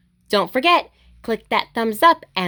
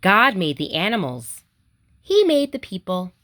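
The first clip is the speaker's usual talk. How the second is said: put-on reading to children; 2 semitones lower than usual. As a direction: read it gently to little kids, each line a small wonder. Reading picture books aloud.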